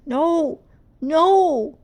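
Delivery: high, loud panicked cry; whimpering. Acted. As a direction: cover up in panic. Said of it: "she whimpered".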